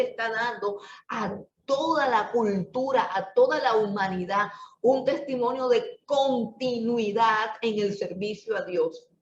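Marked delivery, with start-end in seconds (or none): none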